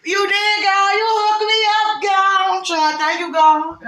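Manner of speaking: high, loud, half-sung thanks; joyful praise. Real, not acted. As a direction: burst out praising God, almost singing. Overjoyed praise to God.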